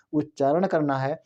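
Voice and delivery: ordinary talk, nothing marked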